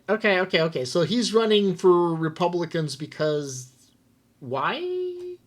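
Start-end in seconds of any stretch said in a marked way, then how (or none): 4.4-5.4 drawn-out word sliding way up high; high, leading question; questioning prompt. Real, not acted. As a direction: stretch it into a high rising question. Prompts co-host to finish sentence.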